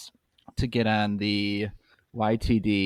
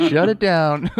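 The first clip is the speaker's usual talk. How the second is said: laughing; 8 semitones higher, 7 dB louder, slower than usual. Real, not acted. Joking reaction, laughing.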